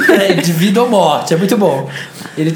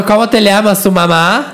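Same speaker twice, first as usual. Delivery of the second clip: put-on Spanish accent, raised pitch; 4 semitones higher than usual. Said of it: "ama seu sotaque espanhol".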